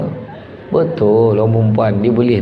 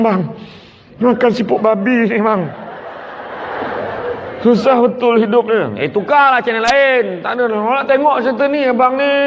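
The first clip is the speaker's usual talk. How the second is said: higher, nagging back-and-forth voices; mock TV quarrel; 8 semitones higher than usual. Wife and husband argue over the TV drama, higher and whiny. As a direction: bicker over the channel like a married couple.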